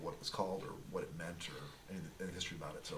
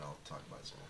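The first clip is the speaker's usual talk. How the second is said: softly trailing off; 6 semitones lower, 12 dB quieter, much faster than usual. Real, not acted. Drops to whisper.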